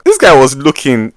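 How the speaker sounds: usual speaking voice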